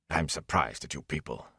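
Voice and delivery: low, soft rumble; mild rumbling reproach; rumbled. A narrator's reading. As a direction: rumble your disappointment. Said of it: "Bran rumbled".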